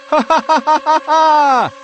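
put-on high, loud childlike laugh; giddy laughter. A narrator's reading. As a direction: laugh like a kid at Christmas. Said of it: "laughed like a four-year-old".

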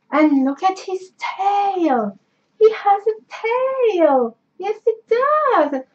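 high, slow, drawn-out cooing; adoring coo. Real, not acted. Showing off a plush toy's tail, adoring coo.

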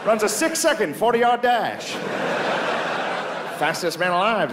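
put-on high old-timey play-by-play; old radio announcer. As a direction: hype the pitcher like a vintage radio man. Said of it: "You can hear the announcers".

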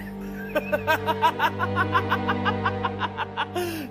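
high frenzied cackle; maniacal laugh. Acted. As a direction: cackle like a madman. Madman laughing.

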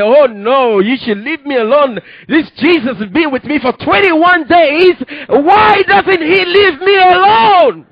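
rising to a high, loud crying shout; anguished outcry. Retold or imitated. Recalling his desperate plea to the Jesus-sent stranger, crying shout. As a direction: cry out in distress, begging to be left alone.

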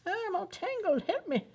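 cutesy cry for help; playful whine. Pretend. Playful plea to the bird.

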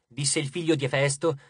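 usual speaking voice

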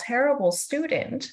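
usual speaking voice

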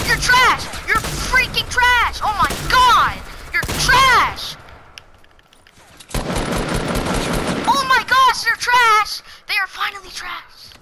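very high, very loud triumphant yelling; gleeful shout. Real, not acted. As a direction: scream it in triumph. Winning fights, taunting.

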